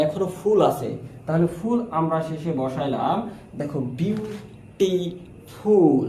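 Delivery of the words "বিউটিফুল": বিউটিফুল very slowly, with gaps after বিউ and টি; three separate stretched beats for বিউটিফুল, বিউ, টি, ফুল; split into syllables. Shown as a demonstration. Teacher counts the syllables for students, বিউটিফুল in three slow parts. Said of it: "তিনবারে উচ্চারিত হয়েছে".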